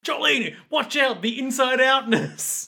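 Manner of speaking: high, drawn-out dramatic cry; mock warning shout. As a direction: call out a hammed-up warning.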